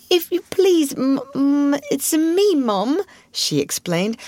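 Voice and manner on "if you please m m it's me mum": put-on high, stammering servant girl; frightened young maid. A narrator's reading. Scullery maid caught hiding, stammering and scared. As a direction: stammer it out, scared of her mistress. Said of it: "red with repressed crying".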